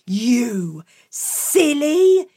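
slow, drawn-out scolding; scolding mum. Acted. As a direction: wag the finger with every word. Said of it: "she said".

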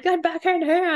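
put-on high, loud yell after a runaway kid; frantic mum shouting down the street. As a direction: call him back sharply. Yelling after kids running off.

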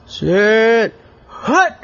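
loud, high football call; calling the snap. Acted. Quarterback shouting the snap to his team, loud and high. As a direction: bark the snap count up high.

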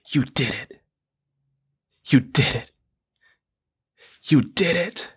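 choked-up retelling with long pauses; holding back emotion. Real, not acted. Quoting his wife's good news, trying not to get emotional. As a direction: fight to stay composed while quoting. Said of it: "try not to get emotional here".

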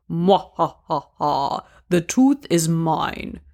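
slow, hammed-up Russian mobster villain laugh; dad's bad Russian mafia accent before pulling a tooth. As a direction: play a cartoon Russian mafia boss gloating, drawn out. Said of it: "in a bad Russian mafia accent".

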